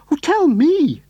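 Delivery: high, loud begging cry; eager cry. A narrator's reading. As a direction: beg him to tell. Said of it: "cried Missus Silver".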